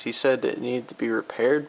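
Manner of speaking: normal talking voice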